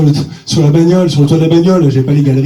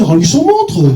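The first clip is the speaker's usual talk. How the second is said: mimicking the tourist's broken French; husband mocking the tourist to Blandine, English accent; 6 semitones higher, slower than usual. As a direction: echo his English accent, higher. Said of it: "Je dis, mais Blandine".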